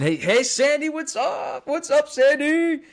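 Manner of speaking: light up and squeal the greeting; high, gleeful hey to a familiar viewer; excited greeting. Real, not acted.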